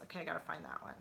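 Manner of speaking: whispering a note to herself; whispered aside. Real, not acted. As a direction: drop to a whisper, half to yourself. Aside to herself, noting channel.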